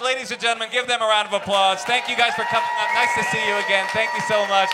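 rally the crowd for a round of applause; announcer sign-off. Acted. Host thanking the guests on stage, announcer voice.

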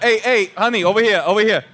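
high, put-on dad summoning someone; mock impatient call across the restaurant; calling the waitress. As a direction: imitate a pushy diner hailing the waitress.

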